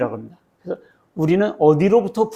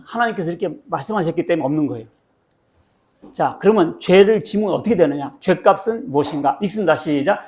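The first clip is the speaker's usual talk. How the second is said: ordinary talk, nothing marked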